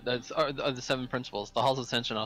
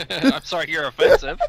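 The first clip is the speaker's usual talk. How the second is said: sarcastic apology; 6 semitones higher, 13 dB louder than usual. Acted. Mock apology shot back.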